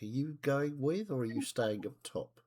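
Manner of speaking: ordinary delivery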